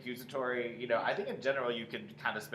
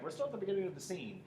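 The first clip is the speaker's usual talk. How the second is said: ordinary talk, nothing marked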